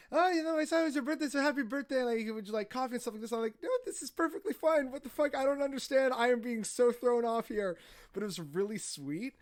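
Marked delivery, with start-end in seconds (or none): 0.1-3.3 high, fast, eager voice of a hostess; gushing hostess. Retold or imitated. Landlady's surprise birthday welcome. 3.6-7.8 high, rattled and a bit louder; flustered surprise. Retold or imitated. His flustered reply to surprise cake.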